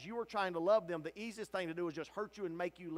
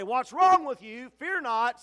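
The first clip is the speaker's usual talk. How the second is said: angel's rebuke; 6 semitones higher, 14 dB louder than usual. Acted. Angel shakes the weeper.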